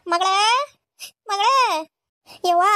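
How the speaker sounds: calling her daughter, voice rising with worry; tearful calling out. Acted. Mother calls for her daughter, tearful and rising. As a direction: cry out for the girl, close to tears.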